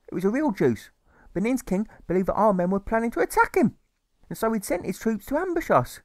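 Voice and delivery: high, fretful mother's voice; fussy mother. Acted. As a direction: nag him like a doting, anxious mother. Mother's long tale voiced.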